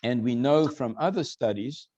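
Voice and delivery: usual speaking voice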